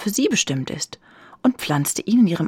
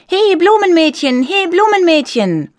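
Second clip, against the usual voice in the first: loud, high calling out; shouting; 12 semitones higher, 9 dB louder than usual. A narrator's reading. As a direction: holler to a girl down below. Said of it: "schrie die Stimme".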